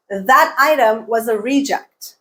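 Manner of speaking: "reject" as REE-ject, first syllable stressed; noun stress. Shown as a demonstration. Teacher giving a noun example, first-syllable stress. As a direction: stress the start of "reject" within the sentence. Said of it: "you change the stress pattern and the vowel".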